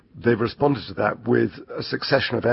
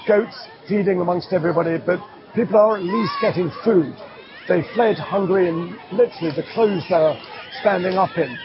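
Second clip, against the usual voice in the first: higher, projected field-reporter delivery; news report; 7 semitones higher than usual. Acted. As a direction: report it firmly in short phrases. Recorded on-location news report.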